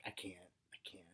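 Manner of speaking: whispered, breathless give-up; whispered despair. Retold or imitated. Young people burned out after Saturn return, whispered. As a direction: whisper it like you're spent. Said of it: "you're like".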